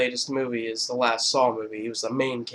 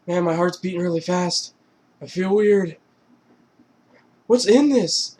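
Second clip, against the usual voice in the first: mock panic, rising higher and louder; Jonah Hill; 6 semitones higher, 5 dB louder than usual. Drugged man realising something is wrong, mounting mock panic. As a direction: build from uneasy to alarmed.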